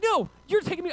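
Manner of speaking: high, loud comic outburst; mock angry retort. Balaam quarrelling with his donkey, high mock-angry retort. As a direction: argue back at the donkey, hammed-up.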